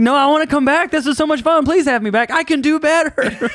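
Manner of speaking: high, pleading mock begging; desperate pleading. Comic begging the host for another booking, high and pleading.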